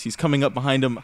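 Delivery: normal talking voice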